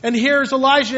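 usual speaking voice

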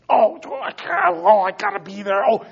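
high-pitched hammed-up panic; mock rushed fluster. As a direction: play someone frantic and late. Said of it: "in a rush".

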